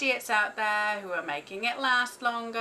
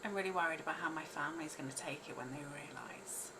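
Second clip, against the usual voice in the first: fast, hushed worried whisper; whispered worry; 4 semitones lower, 9 dB quieter, faster than usual. Real, not acted. Whispering her worry about how her family will take it. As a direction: confide the fear in a whisper. Worried confession while tapping.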